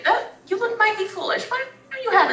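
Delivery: put-on old halfling woman. Elderly halfling Tira teasing the hiding man, high old voice. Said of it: "and I say".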